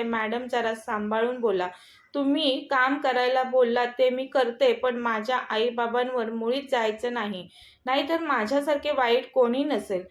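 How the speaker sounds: ordinary delivery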